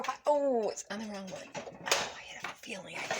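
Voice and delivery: whispered to herself; whispering while cooking. Real, not acted. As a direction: breathe it out under her breath. Muttering to herself over the stove.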